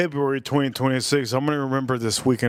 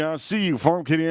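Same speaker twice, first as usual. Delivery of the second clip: ordinary talk, nothing marked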